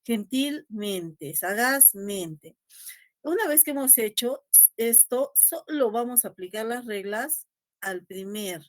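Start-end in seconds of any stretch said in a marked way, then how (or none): 0.0-2.6 "Gentilmente", "sagazmente" spelled out slowly in parts; careful classroom syllables; slow, separated. Shown as a demonstration. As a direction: slowly split "gentil" from "mente". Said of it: "lo separamos mentalmente".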